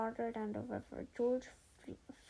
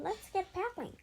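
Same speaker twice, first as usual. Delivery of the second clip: calling out; 8 semitones higher than usual. A narrator's reading. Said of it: "Sage called to him".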